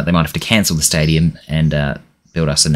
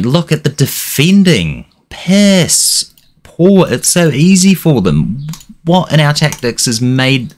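raised, exasperated yelling at the screen; frustrated manager shouting at his players; angry rant; 6 semitones higher than usual. Real, not acted. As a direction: berate the defenders, voice up high. Angry at his team's defending.

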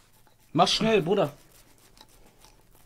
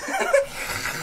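high, soft giggle; squeaky quiet laughter; 12 dB quieter than usual. Real, not acted. Laughing amid face painting.

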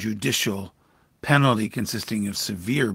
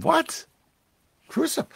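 much higher, shocked double question; high stunned disbelief, asking it back; incredulous Pilate. Acted. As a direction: Pilate can't believe his ears.

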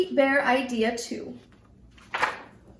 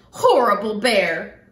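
stomping angry cry; 5 semitones lower, 4 dB louder than usual. Acted. Girl stomps, repeating her cry.

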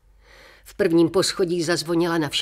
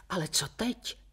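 whisper; 3 semitones higher, 6 dB quieter than usual. A narrator's reading. Worried wife whispers her fear.

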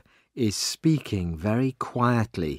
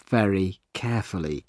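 usual speaking voice

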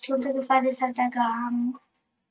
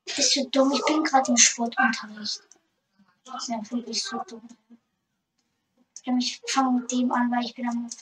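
normal talking voice

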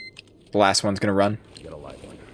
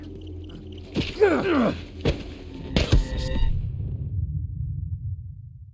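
long, high, helpless laughter; real laughing fit; 6 dB quieter than usual. Real, not acted. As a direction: crack up and keep laughing. Laughs after testing guard's threat.

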